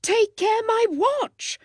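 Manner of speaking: high, loud, slow alarmed plea; alarmed cry. A narrator's reading. Mister Beebe cries to the boys about his watch, high alarmed cry. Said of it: "cried Mister Beebe".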